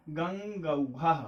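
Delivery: गंगौघः stretched out slowly; drawn out for the class; slow model word. Shown as a demonstration. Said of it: "तो क्या बन जाएगा". (धीरे उच्चारण)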